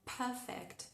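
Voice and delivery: "perfect" en inglés británico, sin la R; British accent. Shown as a demonstration. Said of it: "si queremos sonar más ingleses". ("perfect" con acento británico)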